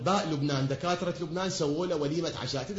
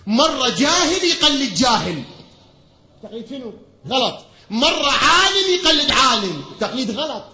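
high, loud and slow pulpit cry; full-force preaching; 5 semitones higher, 9 dB louder than usual. Acted. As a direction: thunder the point out to the congregation, drawing it out. Preaching, chanting the four cases. (خطابة منبرية بصوت عالٍ وممدود)

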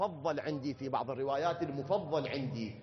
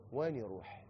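softer, quiet repeat of وين يروح; soft echo; 5 semitones lower, 6 dB quieter than usual. Shown as a demonstration. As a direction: let وين يروح fall away hushed. (وين يروح بصوت خافت)

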